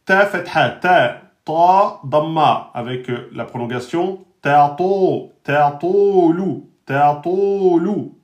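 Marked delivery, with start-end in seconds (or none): 0.1-2.7 dictating "Ta fatha, ta" to the class; letter by letter. Shown as a demonstration. Teacher dictates letters slowly. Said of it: "je vous dicte les règles". 4.4-8.2 "ta to lou" drawn out slowly, ta heavy; dictating "ta to lou" syllable by syllable. Shown as a demonstration. Teacher to class, slow careful syllables. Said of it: "le ta emphatique, avec deux temps".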